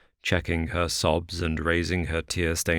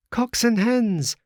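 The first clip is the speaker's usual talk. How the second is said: put-on very high, loud excited child's call; a delighted child shouting at birds; gleeful cry; 5 dB louder than usual. A narrator's reading. Little girl gleefully calls out at the vultures, high and loud. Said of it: "cried the little girl gleefully".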